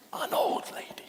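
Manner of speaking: breathy whispered aside; hushed, fully whispered; stage whisper. Acted. Voicing the audience's assumption.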